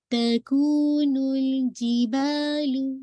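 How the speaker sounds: الْجِبَالُ with ج held, no air; slow recitation demo; clean jeem. Shown as a demonstration. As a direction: recite تَكُونُ الْجِبَالُ slowly. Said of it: "اب یہ کیسے نہیں جی ہو رہا ہے".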